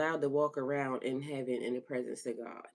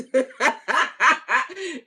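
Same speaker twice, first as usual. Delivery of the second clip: long, high, loud peals of laughter; real laughing fit; 10 semitones higher, 11 dB louder than usual. Real, not acted. Host tickled at being called dust, laughing hard. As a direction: burst out cackling.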